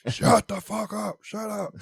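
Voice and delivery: slow, high, loud retold shout; acted liquored-up yell, pitch way up; drunken yelling. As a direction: holler it at the whole party, stretched out. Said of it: "I'm telling everybody to shut the fuck up".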